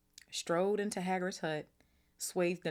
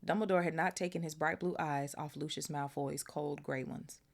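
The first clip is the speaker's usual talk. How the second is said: ordinary delivery, nothing marked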